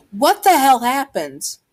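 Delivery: high, loud shocked question; acted motherly outburst; alarmed mother. Mother finds child bruised.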